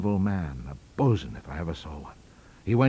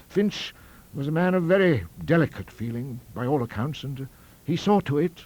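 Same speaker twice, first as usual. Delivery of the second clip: higher-pitched reminiscing college don; rambling academic; 6 semitones higher, 3 dB louder than usual. A narrator's reading. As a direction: recall old scandal, drifting into wistful vagueness.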